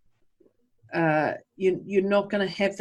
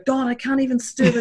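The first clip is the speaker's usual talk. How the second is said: higher-pitched self-berating outburst; playful, raised-pitch frustration at yourself; mock exasperation; 7 semitones higher, 4 dB louder than usual. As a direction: act out despair over a botched drink. Retold self-beating over spilt drink.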